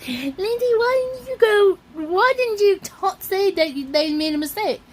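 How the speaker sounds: high-pitched mock complaint; imitating commenters. Imagined viewers questioning Lindy in the comments, high mock complaint. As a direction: plead like a put-on nagging viewer. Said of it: "saying in the comments".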